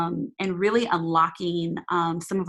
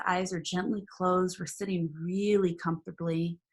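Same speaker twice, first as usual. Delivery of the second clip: guided meditation; 4 dB quieter than usual. Acted. Leads a meditation.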